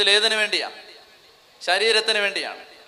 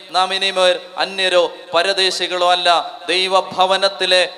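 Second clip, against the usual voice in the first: slow, loud and deliberate proclamation; preaching scripture; 5 dB louder, slower than usual. Acted. Preacher declares the Ephesians verse, slow and loud. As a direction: declare each phrase firmly.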